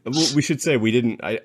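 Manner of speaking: ordinary delivery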